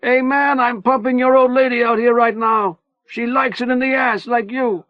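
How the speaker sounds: raging taunts at the inmates; screaming his lungs out. A narrator's reading. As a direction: scream every insult over the wall. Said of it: "Manny was screaming his lungs out".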